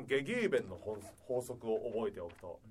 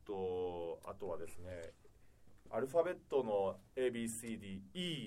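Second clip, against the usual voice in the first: ordinary talk, nothing marked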